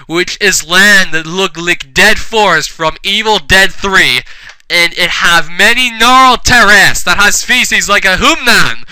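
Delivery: loud, emphatic mock-dramatic reading; over-earnest storyteller; hammed-up story narration. A narrator's reading. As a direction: narrate a terrible fanfic with full conviction. Reads fanfic story aloud.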